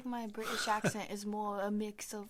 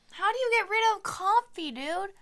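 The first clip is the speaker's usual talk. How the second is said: loud, high disbelief at the vote; exasperated outburst; 9 semitones higher, 10 dB louder than usual. Real, not acted. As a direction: blurt it out in disbelief.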